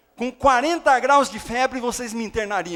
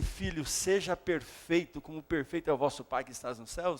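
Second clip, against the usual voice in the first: Jesus's words; 6 semitones lower, 9 dB quieter than usual. Acted.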